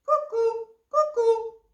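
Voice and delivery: high, loud two-note bird call; cuckoo. Acted. Cuckoo spots the fleeing fish, cuckoo call. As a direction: hoot it out, falling on the second note.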